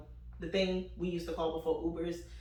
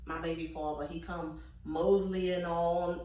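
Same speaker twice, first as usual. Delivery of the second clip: normal talking voice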